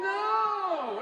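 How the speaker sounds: a high, loud flat refusal; blunt loud no. Retold or imitated. Speaker to the kid selling chocolates, a loud blunt no. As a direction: slam the door on the kid. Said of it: "that's what I said".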